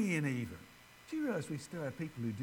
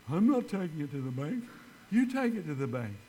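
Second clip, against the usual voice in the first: louder, firm refusal in another voice; indignant secretary; 6 dB louder than usual. Retold or imitated. Secretary refusing to carry the cash to the bank, loud and indignant. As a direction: push the job back onto him, loud and firm.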